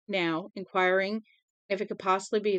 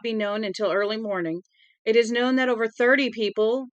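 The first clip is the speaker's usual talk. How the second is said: crisp newscaster read, a bit louder; radio news bulletin; 4 dB louder than usual. Acted. Broadcaster on the radio announcing the deadly fire, news read. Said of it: "The broadcaster said".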